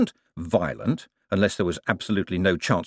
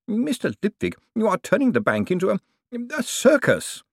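high, prim, scandalised cashier; indignant Bent; 12 semitones higher than usual. A narrator's reading. Chief cashier protesting to Moist, scandalised. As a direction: gasp out the word circus in horror.

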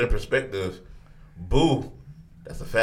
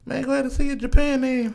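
high-pitched tease; faster than usual. Real, not acted. Teasing cohost, very high fast.

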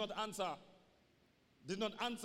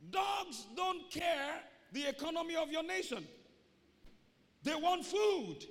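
slow, loud emphatic preaching; preaching shout; 7 semitones higher, 3 dB louder, slower than usual. Real, not acted. Preacher joking to church, loud slow shout. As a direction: drive it home, word by word.

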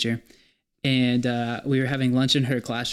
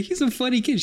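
high mock voice; 12 semitones higher than usual. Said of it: "I was like".

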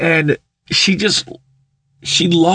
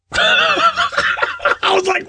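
laughing; much slower than usual. Real, not acted.

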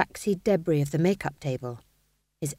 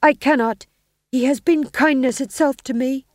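stage husband; 6 semitones higher, 7 dB louder than usual. Acted. Winslow in the play.

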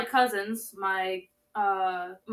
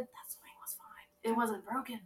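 whispering; 9 dB quieter than usual. Acted. Mysterious reveal, hushed.

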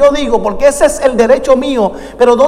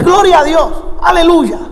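higher-pitched, ringing church exclamation; reenacted praise shout; 5 semitones higher than usual. Visiting preacher greeting a church on entering, exclaimed praise. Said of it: "¿por qué grita?".

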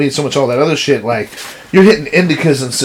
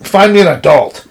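a louder, scornful demand for a real adult; mock indignant demand; 5 semitones higher, 4 dB louder than usual. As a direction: snap it out like an offended customer. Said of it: "I was so mad".

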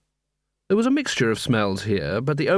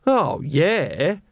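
sarcastic; 4 semitones higher than usual. A narrator's reading. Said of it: "snarled Angua sarcastically".

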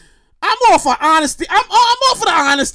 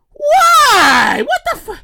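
high, drawn-out disbelieving yell; exasperated shout; 9 semitones higher, 3 dB louder, much slower than usual. Real, not acted. Ranting about people returning cash, exasperated shout. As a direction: stretch the word out in exasperation.

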